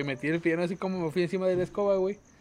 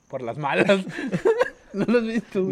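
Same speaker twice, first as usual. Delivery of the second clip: laughing; 2 semitones higher than usual. Retold or imitated. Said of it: "el don afuera con su bolsa".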